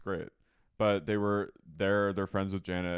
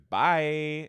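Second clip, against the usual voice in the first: loud, higher weird bye; Jax; 4 semitones higher, 8 dB louder than usual. Retold or imitated. Husband slams door on wife, weird loud bye. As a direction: lightly mimic an odd, dismissive send-off. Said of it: "He does this weird bye".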